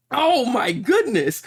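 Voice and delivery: loud, high, drawn-out disbelief; shocked outcry. Real, not acted. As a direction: cry out slowly in stunned amazement. Shocked live reaction to gory page.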